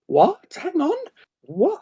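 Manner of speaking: high, rising what, what, hang on; double take. Retold or imitated. Spotting a hidden gem in the data, stunned high double take. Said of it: "You'll see something and go".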